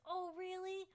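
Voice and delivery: high, bright-eyed "Oh, really?"; excited little goblin. Acted. Goblin answering Geth's compliment, high and excited. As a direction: perk up like an eager goblin.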